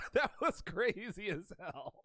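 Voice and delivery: soft, slow words through laughter; laughing. Real, not acted. Storyteller amused by his own crazy story, laughing softly. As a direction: chuckle your way through it.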